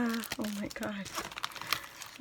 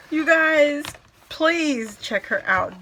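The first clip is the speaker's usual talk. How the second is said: loud urging; 4 semitones higher, 7 dB louder than usual. Real, not acted. Urging viewers loudly.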